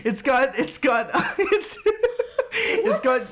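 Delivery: high, squeaky words broken by laughing; helpless laughter while talking. Real, not acted. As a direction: insist on it while cracking up. Cracking up defending the film.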